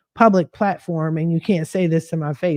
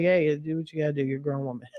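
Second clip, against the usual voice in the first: soft, quick, offhand reply; low-key retold permission; 3 semitones lower, 8 dB quieter, much faster than usual. Mom leaving it to her grown daughter, softly resigned. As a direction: toss it off quietly, letting it go. Retold mother giving leave.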